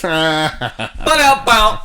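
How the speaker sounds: slow drawn-out rimshot; rimshot ba-da-bow. Pretend. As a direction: sing the rimshot through a laugh. Punchline sting.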